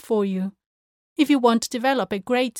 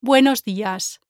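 "Buenos" emphasized louder, "días" falling away; stress on BUENOS; 3 dB louder than usual. Shown as a demonstration. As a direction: lean on "Buenos", let "días" drop. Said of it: "how I say it".

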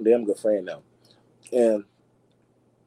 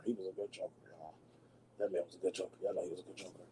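much softer, lower murmur; hushed aside; 4 semitones lower, 18 dB quieter, faster than usual. Real, not acted. Vlogger confides to viewers about Heath Ledger, hushed and low. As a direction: drop way down as if confiding.

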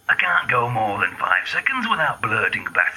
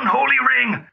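blurted outburst; 11 semitones higher, 4 dB louder than usual. Acted. Sneeze swear outburst.

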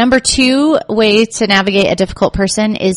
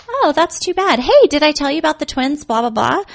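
breezy brush-off; 8 semitones higher than usual. Retold or imitated. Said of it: "I would say".

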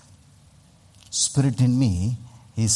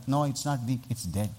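quiet firm reply; 9 dB quieter than usual. Retold or imitated. His own quiet reply to callers.

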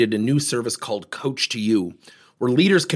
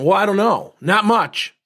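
knee-jerk dismissive; 8 semitones higher, 4 dB louder than usual. Retold or imitated. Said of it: "the knee-jerk triggered reaction".

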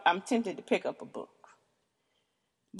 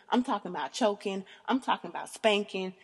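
usual speaking voice

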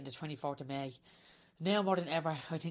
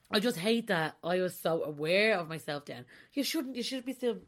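ordinary talk

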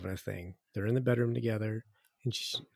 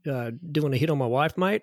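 lightly voiced Aussie hitman request; Australian accent; 4 semitones higher than usual. Asking a friend to kill his wife, in a light Australian accent. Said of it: "It's using an Australian accent".

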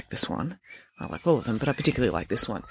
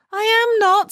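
indignant denial; 5 dB louder, slower than usual. Acted. Friday indignantly denies being embarrassed.

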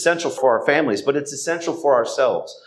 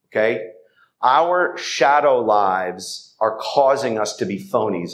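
ordinary talk, nothing marked

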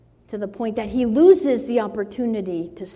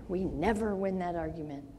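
softer, lower echo of the line; quiet repeat; 5 semitones lower, 9 dB quieter, faster than usual. Shown as a demonstration. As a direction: let it settle, softly.

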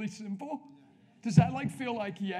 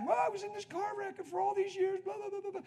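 a lightly raised, high woman's voice; hint of the lady rattling off her story; retold lady; 12 semitones higher than usual. Preacher lightly voicing the lady's car wreck story. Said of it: "I said, "What?".

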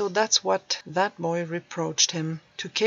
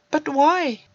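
higher, louder searching question; higher, louder teen asking why; 8 semitones higher, 6 dB louder than usual. Acted. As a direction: ask it with the boy's raised pitch. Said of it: "searched the old man's face for some connection".

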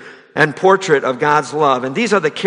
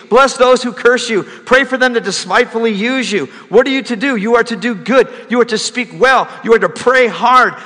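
higher, louder, driving pulpit voice; fervent preaching; 8 semitones higher, 4 dB louder than usual. Acted. Preacher urges the congregation to love enemies, fervent and loud. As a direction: preach it hard to the pews.

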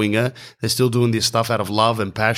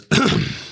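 high, hammed-up throat clearing; mock throat clear; 13 semitones higher than usual. Organiser clearing his throat before announcing, hammed-up. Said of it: "Mark goes".

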